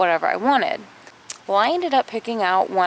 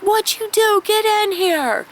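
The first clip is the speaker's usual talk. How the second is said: very high, slow, urgent call; a boy hurrying his sister inside; urgent kid summoning; 11 semitones higher, slower than usual. Retold or imitated. Charlie calling Kim inside urgently, high and alarmed. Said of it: "he's like".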